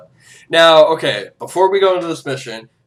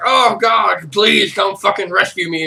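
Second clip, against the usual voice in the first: hammed-up cry for help, pitched up and loud; mock plea for rescue; 5 semitones higher, 5 dB louder than usual. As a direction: call out to your dog in mock desperation. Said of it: "he'll call out his dog".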